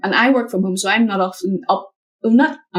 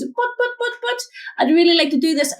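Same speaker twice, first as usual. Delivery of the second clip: mock whiny buts; 9 semitones higher than usual. Imitating her own excuses to the coach, high and whiny. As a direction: stutter out the objections in a small high voice. Mimics objections again.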